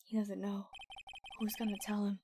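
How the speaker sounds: quiet conspiratorial murmur; softly to the others; hushed aside. Real, not acted. Aside among players.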